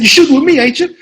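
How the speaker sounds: loud, high, challenging question; Sam Jackson impression. Veteran actor quizzing the nervous newcomer, challenging impression. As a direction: fire it at the rookie forcefully. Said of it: "He said".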